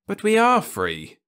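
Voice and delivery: he cannot understand the charge; perplexed. Acted. Ludovic protests to Osmond, high and perplexed.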